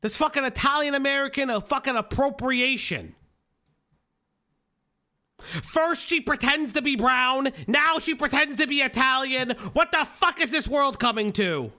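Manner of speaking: high-pitched, hammed-up indignation; mock outrage. Host decrying a fake Italian American accent, mock-outraged. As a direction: play up the scandalised outrage.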